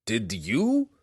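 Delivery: stunned question with a sharp rise; gasped question. A narrator's reading. Belwar stunned by Clacker's stone wall, gasping. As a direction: gasp it in disbelief. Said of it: "the Burrow Warden gasped".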